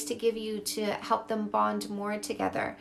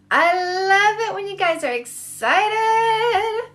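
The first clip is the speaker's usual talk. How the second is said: excited; 8 semitones higher, 10 dB louder, slower than usual. Real, not acted. Excited greeting to stream.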